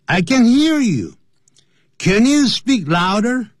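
asking to speak up. Shown as a demonstration. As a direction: call out slowly for them to speak up. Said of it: "要某人提高音量的时候".

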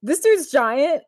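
gawk at the giant on screen, stretched out; amazed disbelief. Retold or imitated. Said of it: "I'm like".

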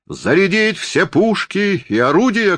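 high-pitched battle order, cried out; Napoleon. A narrator's reading. Stage Napoleon orders the cannons loaded, crying out. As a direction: give the order in a high theatrical call. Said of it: "крикнул Наполеон".

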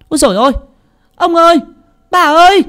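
very high, loud shriek for the masters; panicked cry. Acted. Maid Mơ shrieks for her master and mistress in panic. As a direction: scream out in terror. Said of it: "la lên thất thanh".

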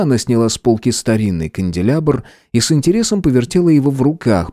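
usual speaking voice

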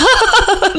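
высокий девичий смешок; звонко рассмеяться; смех Ольги. Acted. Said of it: "Ольга рассмеялась". (Ольга смеётся над Жароном)

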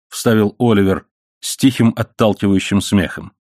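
ordinary delivery

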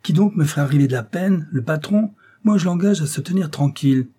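high, loud indignant challenge; angry defiance. Acted. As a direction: bristle like a cocky young rascal. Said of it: "fit-il, rouge de colère".

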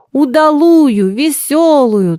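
high, loud, drawn-out call; demanding shouts. A narrator's reading. Rowdy guests call for a merry song, demanding shouts. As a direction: demand it with slow, heavy stresses. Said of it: "требовали голоса".

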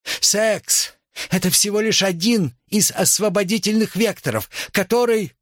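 high, strained-pitch oratory without extra volume; crying out. A narrator's reading. Nicole answering the chanting students, high-pitched cry. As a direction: call over the hecklers. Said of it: "Николь закричала".

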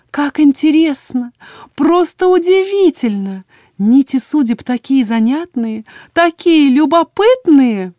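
high, loud, drawn-out wonder; cheerful singsong. A narrator's reading. Healer marvels at threads of fate, merry singsong. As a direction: trill on in slow rapture. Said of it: "весёлым голосом пела Тамплина".

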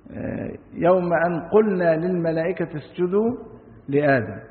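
ordinary talk

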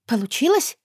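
unsure surprised question, rising high; can't believe it worked, asking; breathless astonished question; surprised disbelief. A narrator's reading. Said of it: "воскликнула удивлённо, сама не веря".

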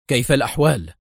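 usual speaking voice

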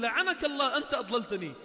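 loud angry curse thrown back; cursing reply. Acted. The second damned man cursing back at the first, loud and angry. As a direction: hurl the same blame right back. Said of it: "والثاني يقول".

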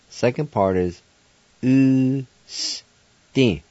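ordinary talk, nothing marked

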